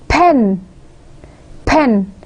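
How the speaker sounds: pen in one single puff of air; one-syllable demo. Shown as a demonstration. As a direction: say pen as one isolated beat, pausing between repeats. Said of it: "with one puff of air".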